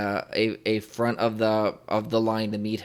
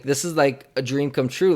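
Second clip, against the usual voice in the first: ordinary talk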